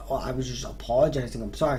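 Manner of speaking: low, softened, sheepish retreat; meek apology. Retold or imitated. Apologizing to the angry girlfriend on the doorstep, meek. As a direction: back down quietly, voice dropped.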